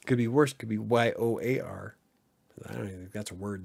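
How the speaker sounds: ordinary talk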